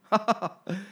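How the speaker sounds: high gleeful giggle; a sly little laugh; cheeky laugh. Acted. Jim laughing to himself, cheeky giggle. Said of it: "En weer lachte die".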